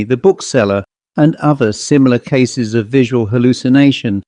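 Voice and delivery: usual speaking voice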